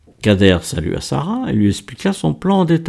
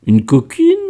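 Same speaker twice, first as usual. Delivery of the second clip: titillated teasing question; 3 semitones higher, 3 dB louder than usual. A narrator's reading. Miguel guesses at Kader's secret lover, titillated. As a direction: probe with tickled, suggestive curiosity. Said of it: "tout à fait émoustillé".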